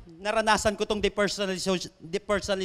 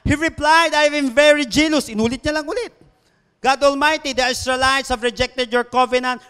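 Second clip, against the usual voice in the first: loud recitation at raised pitch; preached scripture reading; 3 semitones higher, 6 dB louder than usual. Acted. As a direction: drive through Elijah's reply as a sermon reading. Reads Elijah's repeated reply.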